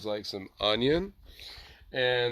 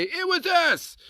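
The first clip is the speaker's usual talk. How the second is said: squeaky, much higher mock cry of It was us; mock English claim; slower than usual. The English claiming the drink, in a squeaky high mock voice. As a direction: pipe it up high like a proud boast. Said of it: "now the English say".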